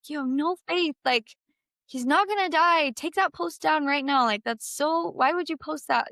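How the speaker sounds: a retold, half-hearted flare of anger at the post; imitating angry scolding. Scolding her cousin over a goodbye post, indignant. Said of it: "and I got mad at her".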